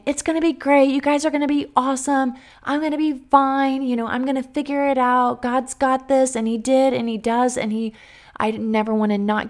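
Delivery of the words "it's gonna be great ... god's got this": high, upbeat reassurance to others; forced cheer. Retold or imitated. Teacher reassuring colleagues on leaving, high and upbeat. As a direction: sell it like everything's fine. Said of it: "instead of saying".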